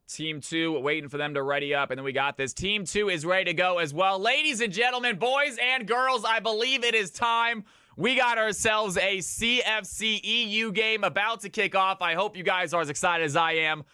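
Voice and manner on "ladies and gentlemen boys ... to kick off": hype announcer. Acted. Caster to stream viewers as the match kicks off, booming announcer hype. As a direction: stretch it like a ring announcer opening the big match.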